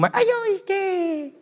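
put-on very high cooing baby voice. Churchgoer likened to a baby in the womb, high baby coo.

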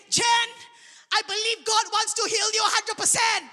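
loud, high proclamation of faith; bold declaration. Retold or imitated. Preacher telling Jen she will be fully healed, bold declaration. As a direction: raise the voice and declare it with conviction.

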